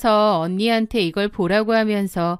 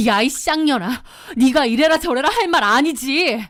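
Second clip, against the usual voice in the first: angry cursing; 4 semitones higher than usual. Acted. Wife curses the mistress.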